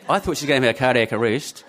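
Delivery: usual speaking voice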